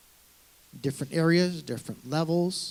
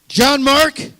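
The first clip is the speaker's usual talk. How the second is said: sharp loud call of both names; stern father's bellow from across the house; dad calling full name; 12 semitones higher, 15 dB louder than usual. Retold or imitated. Dad summoning his son with a loud, stern call. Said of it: "when my dad said".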